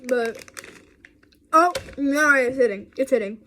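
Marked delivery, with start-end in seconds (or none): 1.5-3.4 louder, startled oh no; sudden reaction. Real, not acted. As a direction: react as the taste hits. Sour hitting suddenly.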